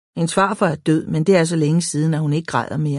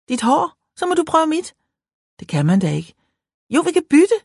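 put-on eager girl; 11 semitones higher than usual. Excited girl proposing hair swap.

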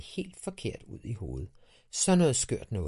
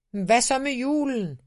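much higher and louder call; shouted question; 14 semitones higher, 10 dB louder than usual. A narrator's reading. Dad shouts after his son about Christmas. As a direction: yell it across the yard.

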